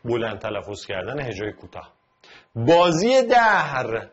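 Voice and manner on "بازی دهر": بازی دهر stretched slowly, loud and high; drawn-out metric reading; slow syllable reading. Shown as a demonstration. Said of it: "با، ز، ی".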